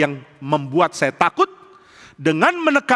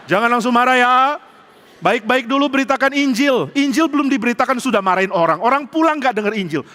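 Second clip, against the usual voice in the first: higher-pitched voice of a cautioning wife; imitating wife's admonition; 5 semitones higher than usual. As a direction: play the wife reminding him, pitched up. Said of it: "tiba-tiba ingat wajah istri saya". (menirukan istri menasihati, nada tinggi)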